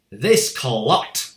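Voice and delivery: loud booming insult; boomed. A narrator's reading. Headmistress insulting the boy before the school, booming. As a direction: boom it at the boy. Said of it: "boomed the headmistress".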